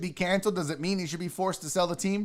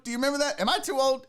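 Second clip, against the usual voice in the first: normal talking voice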